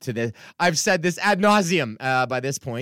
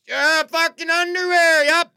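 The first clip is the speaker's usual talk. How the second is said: put-on much higher, louder, slow drunk blurting; drunk heckler; 7 dB louder, slower than usual. Comic imitating a drunk heckler answering his joke, loud and slow. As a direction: ham up a sloshed guy shouting back at the stage. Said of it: "this sort of audience member will be like".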